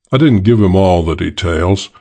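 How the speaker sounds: plain reading voice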